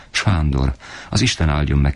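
usual speaking voice

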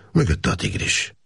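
usual speaking voice

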